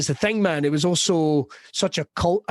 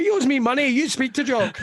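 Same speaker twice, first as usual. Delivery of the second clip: mock gruff Scot reply; 8 semitones higher than usual. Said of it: "just to see if I'll put them on the back foot".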